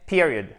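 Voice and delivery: "period" with a strong first syllable, then falling; stressed first syllable. Shown as a demonstration. Teacher models the English word for punctuation, first-syllable stress. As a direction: hit the start of "period", short i. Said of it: "accent tonique".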